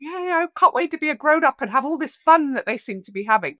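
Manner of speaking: put-on high, fast, breathless kid's excitement; awestruck little girl. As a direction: gush like a seven-year-old dazzled by grown-ups. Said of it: "look at these adults in awe and be like".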